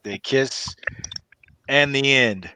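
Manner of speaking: normal talking voice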